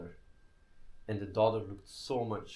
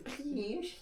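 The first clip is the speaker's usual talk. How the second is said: put-on very high, squeaky baby talk; mock her baby voice, pitched way up; cutesy baby voice; 4 dB quieter than usual. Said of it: "why do you talk in a baby voice".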